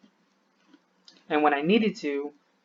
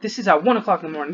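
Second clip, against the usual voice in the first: angry outburst; 4 semitones higher, 4 dB louder than usual. Real, not acted. Ranting about loud cars at night.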